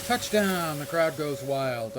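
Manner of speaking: big triumphant cheer; excited shout. Pretend. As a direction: shout it like a sports announcer. Celebrating a touchdown in game.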